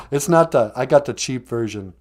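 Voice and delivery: plain reading voice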